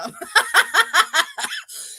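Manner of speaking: loud high laugh; loud squealing laugh. Real, not acted. Laughing at the joke.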